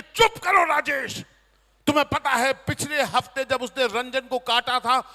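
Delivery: loud, high scolding shout; wife snapping. Retold or imitated. Wife loudly snaps at husband to shut up. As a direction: shut him down hard.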